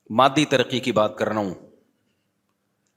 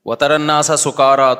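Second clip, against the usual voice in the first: louder chanted Arabic verse; Quran recitation; 6 dB louder than usual. Acted. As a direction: recite it with full voice.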